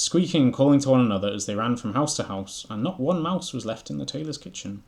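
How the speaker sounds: loud, lively read-aloud narration; animated storybook narration; story reading. A narrator's reading. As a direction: read it out brightly to the listeners.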